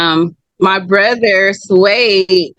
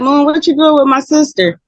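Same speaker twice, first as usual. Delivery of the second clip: higher, lightly mimicked challenge; imitating brother; 8 semitones higher than usual. Brother challenging the boyfriend, lightly mimicked higher. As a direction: confront him, pitched up a bit. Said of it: "he was like".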